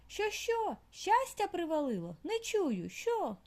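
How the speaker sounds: high, insistent half-shout; deaf mole shouting. A narrator's reading. Deaf mole shouts questions at squirrel, high and insistent. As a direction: keep yelling because he cannot hear. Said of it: "закричав кріт".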